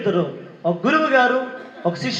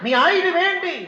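high shout of the question to the hall; preacher shouting the question at the congregation; shouted call; 9 semitones higher than usual. Acted. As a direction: push it out as a rallying cry. Repeated call, now shouted.

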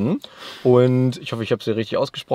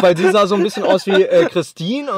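laughing; 5 semitones higher, 6 dB louder than usual. Real, not acted. Recalling joking about the book.

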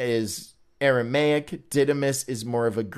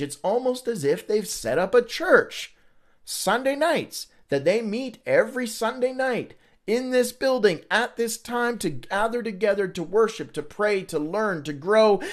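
higher, knowing mock surprise; sarcastic wonder; 6 semitones higher than usual. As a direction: act amazed you didn't see it coming.

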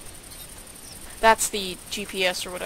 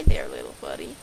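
much lower, cooing voice; coaxing a wild bird. Pretend. Greeting the bird.

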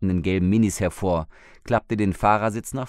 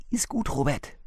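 ordinary delivery, nothing marked

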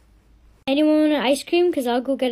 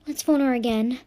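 normal talking voice

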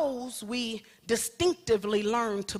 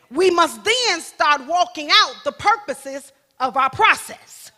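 preaching at full force; 4 semitones higher, 9 dB louder than usual. Real, not acted. Preacher shouting the process to the congregation, full force.

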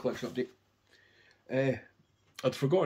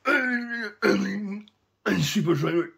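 slow, higher, louder wasted slur; slurring high; 10 semitones higher, 9 dB louder, much slower than usual. Retold or imitated. Fan high on MDMA asking about the band, slow slurred voice. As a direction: stumble over the words, out of it. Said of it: "And I went".

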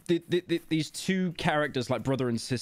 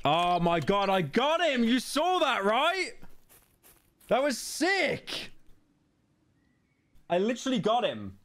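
high, thrilled exclamation; real excitement; 8 semitones higher than usual. Real, not acted. Gamer celebrating a three sixty hit, high real excitement. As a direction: leap up in pitch, amazed at yourself.

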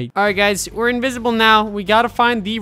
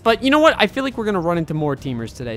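normal talking voice

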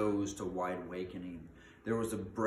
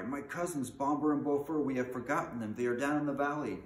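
high, urgent outcry; raised voice of acted panic; alarmed cry; 7 semitones higher, 5 dB louder than usual. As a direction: cry out in sudden dismay. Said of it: "when Buffer gave a cry".

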